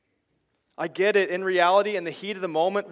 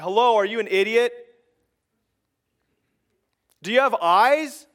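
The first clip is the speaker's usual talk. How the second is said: louder, higher, exasperated jabs; heated insult; 3 semitones higher, 6 dB louder than usual. Retold or imitated. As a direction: hurl the insults like a fed-up spouse. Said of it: "you'll say something like".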